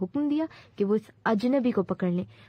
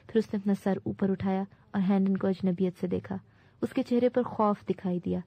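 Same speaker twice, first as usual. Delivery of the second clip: ordinary delivery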